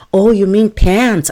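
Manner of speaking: high, loud, drawn-out sudden understanding; mock realization. Coworkers finally grasping what trousers means, exaggerated realization. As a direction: act out the penny dropping, loudly.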